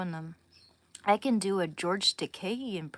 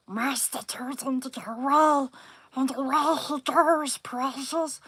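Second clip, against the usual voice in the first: higher, louder creature hiss; Smeagol voice; 6 semitones higher, 6 dB louder than usual. Retold or imitated. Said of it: "I'll do Smeagol".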